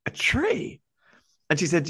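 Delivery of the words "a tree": high, baffled disbelief. Retold or imitated. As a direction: repeat it as if it makes no sense. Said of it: "I was like".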